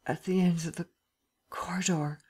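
halting, fading into a whisper; groggy mumbling. A narrator's reading. Drugged Lupin directs his bearers, groggy mumbling. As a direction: break the phrase up, half conscious. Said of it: "he muttered".

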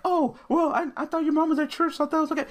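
high mocking voice for the neighbor's excuse; high whiny mock of the neighbor. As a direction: sneer her excuse back in a high put-on voice. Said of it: "she was like".

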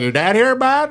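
high-pitched mock Vermont local; Vermont accent. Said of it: "there's this Vermont accent".

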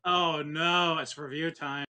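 ordinary delivery

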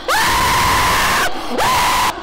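very high shriek; panicked scream. Real, not acted. Player screams as Shrek catches up. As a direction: scream in terror.

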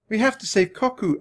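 high, desperate plea; high-pitched distressed cry; urgent cry. A narrator's reading. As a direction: plead urgently to save a friend. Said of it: "Annie cried".

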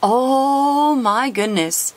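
high, very slow marvelling; drawn-out amazed sigh of delight; awestruck. Real, not acted. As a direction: savour the wonder. Admiring rare card.

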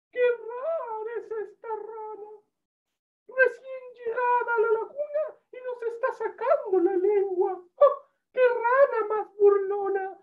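very high, squeaky tiny-bug voice; little ladybird voice. A narrator's reading. Ladybird chasing a strange frog away, squeaky scolding. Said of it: "una chinita que volaba por ahí".

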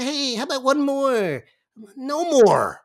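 imitating Bill Raftery at late night. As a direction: egg him on like Raftery. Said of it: "And he said".

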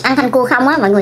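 talking while chewing food; slightly muffled by food; mouth full, chewing. Real, not acted. Seen 2: Noodles eaten just before; lips pursed, chewing at 0.1-0.9s.

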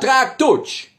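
shouted city name backwards; backwards speech. Acted. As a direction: yell it in reverse. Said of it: "rückwärts".

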